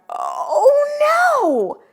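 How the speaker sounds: high, loud cry of alarm; mock alarm oh no. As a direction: gasp it out in mock panic. Dinosaur arrives in story.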